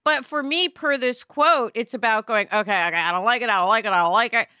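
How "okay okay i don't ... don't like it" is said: ordinary talk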